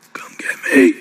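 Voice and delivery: breathy whispered plea; a son imitating his dying father's whisper. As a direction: whisper it like you have no breath left. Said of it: "My dad would talk like this".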